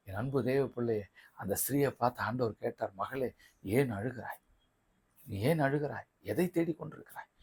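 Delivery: soft, low, gentle preaching; quiet, loving question; tender appeal. Acted. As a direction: lean in softly to comfort the weeping. Voices the Lord asking tenderly.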